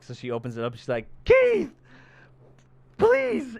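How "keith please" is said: very high, loud desperate call; mock scream down a stairwell, each word apart; imitated scream. Imitating heroine calling down the stairs, desperate scream.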